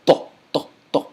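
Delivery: to with a heavy T; to with a thick T against the teeth; strong to. Shown as a demonstration. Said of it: "You're making that strong T sound".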